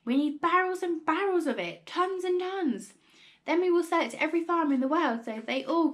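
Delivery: high, loud, eager proclamation; carried away with excitement; excited cry. A narrator's reading. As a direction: pitch it up like a man with a grand scheme. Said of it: "cried Killy Cranky".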